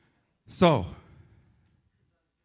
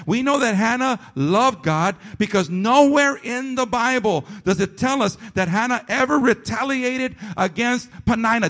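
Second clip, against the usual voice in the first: loud, higher preaching cadence; preaching at full force; 8 semitones higher, 6 dB louder than usual. Acted. Pastor pressing the point on his congregation, loud full-force preaching. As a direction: drive the point home from the pulpit.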